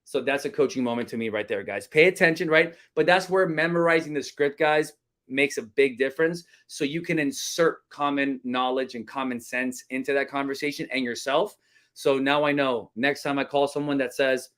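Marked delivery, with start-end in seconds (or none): none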